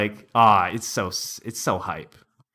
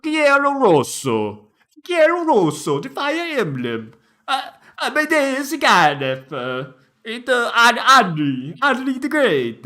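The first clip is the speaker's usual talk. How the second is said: mock Italian accent; 8 semitones higher than usual. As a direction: ham it up in exaggerated Italian. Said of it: "this is the Italian video release".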